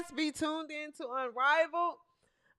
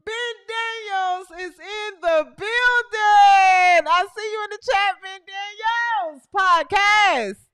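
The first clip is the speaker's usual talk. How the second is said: hype shout-out; 4 semitones higher, 8 dB louder than usual. Real, not acted. Host welcoming Ben Daniels in the chat, hyped shout-out. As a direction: announce the guest like a big arrival, loud and higher.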